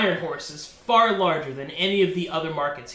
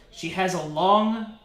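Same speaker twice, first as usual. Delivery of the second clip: ordinary talk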